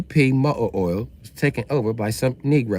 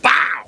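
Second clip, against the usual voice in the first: mock punch sound; 12 semitones higher, 5 dB louder than usual.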